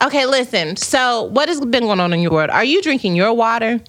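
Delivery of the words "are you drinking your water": ordinary talk, nothing marked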